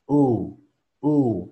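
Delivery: "U" with air and tone varying slightly; wavering U. Shown as a demonstration. Teacher demonstrates the phoneme, gliding "U". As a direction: let the tone of "U" bend as it sounds. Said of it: "crea algo similar a esto".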